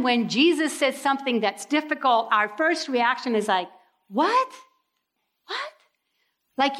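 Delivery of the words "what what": higher, startled double take; mock bafflement; baffled. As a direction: squeak out a confused what. Listener's baffled reaction.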